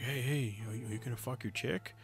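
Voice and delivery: soft, hushed, sleazy question; creepy lurker voice. Retold or imitated. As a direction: lean in quietly like a creep.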